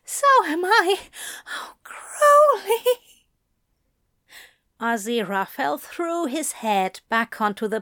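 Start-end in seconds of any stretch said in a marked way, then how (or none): 0.0-2.8 high, breathless desperate pleading; desperate cries. Acted. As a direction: cry out, begging. Aziraphale cries out.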